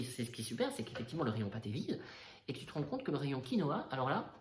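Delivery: usual speaking voice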